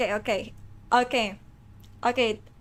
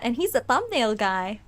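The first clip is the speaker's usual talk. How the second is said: normal talking voice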